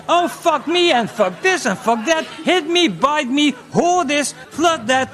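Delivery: high, loud hammed-up begging; mock high moaning woman. Comic quoting a woman begging in bed, high and loud. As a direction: ham up a woman crying out in bed. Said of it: "it was".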